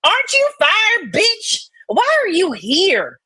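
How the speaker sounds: ordinary talk, nothing marked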